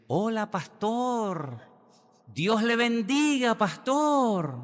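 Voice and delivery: high, slow, sugary greeting; sweet church lady. Retold or imitated. Church sister greets the pastor who just yelled at her, sweetly. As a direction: sing out a sweet hello. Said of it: "una señora y me dice".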